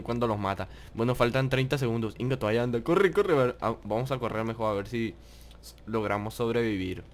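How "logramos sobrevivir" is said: ordinary delivery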